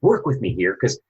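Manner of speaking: high, pushing, frustrated dad voice; losing temper. Retold or imitated. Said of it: "I actually lost my temper".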